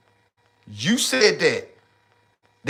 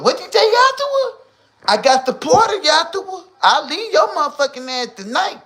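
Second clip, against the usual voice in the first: much higher, louder put-on woman's voice; mock angry woman; 13 semitones higher, 3 dB louder than usual. Woman threatening to leave her man tonight, high mock voice.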